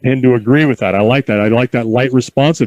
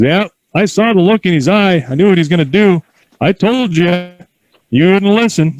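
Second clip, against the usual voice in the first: imitating grandpa; 5 semitones higher than usual. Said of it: "He goes".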